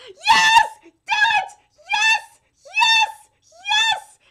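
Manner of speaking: very high, loud cheering; ecstatic shouting. Real, not acted. Fan urging the confession on, ecstatic shrieks. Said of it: "sorry if I'm hurting headphone users".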